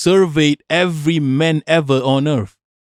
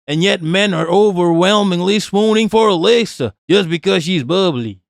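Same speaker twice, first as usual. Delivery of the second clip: put-on Yankee accent, pitched up; 3 semitones higher than usual. Streamer reading a post about two skaters, mock Yankee accent. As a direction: read it out in a raised Yankee accent. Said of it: "Let me do a Yankee accent".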